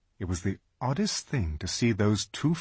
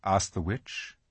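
plain reading voice

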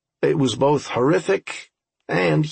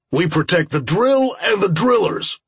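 raised, higher-pitched command; urgent battle order; 6 semitones higher, 3 dB louder than usual. Acted. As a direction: call out the order firmly.

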